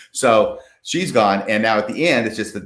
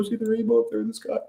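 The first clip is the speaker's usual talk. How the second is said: much higher, fast, soft sweet mother; mom voice; 11 semitones higher, 7 dB quieter, faster than usual. Retold or imitated. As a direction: play the tender mom pointing up. Voicing the dying mom.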